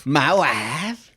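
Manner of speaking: mock-Kazakh catchphrase, rising; stretched foreign comic vowels; Borat. Trading film quotes with a friend, Borat impression. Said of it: "I love Borat".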